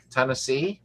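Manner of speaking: usual speaking voice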